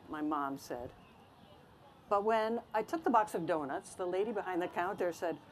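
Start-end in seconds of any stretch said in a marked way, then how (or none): none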